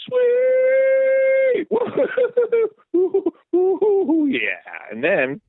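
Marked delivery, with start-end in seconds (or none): 0.1-1.7 very high, loud squeal of joy; delighted squeal. Retold or imitated. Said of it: "I was like". 1.7-4.9 laughing way up high with glee; high giddy laughter. Retold or imitated. Celebrating fumble recovery.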